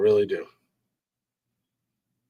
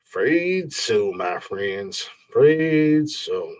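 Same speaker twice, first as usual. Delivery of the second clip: mock solemn; 2 semitones lower, 4 dB quieter, much slower than usual. Mock-solemn verdict to listeners.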